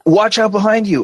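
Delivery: high-pitched game companion warning; urgent warning. Retold or imitated. Companion warns player of danger behind, high urgent call.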